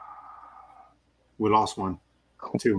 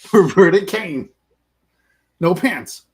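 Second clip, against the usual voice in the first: announcer voice; 3 semitones higher, 7 dB louder, much slower than usual. Pretend. Slow announcer call.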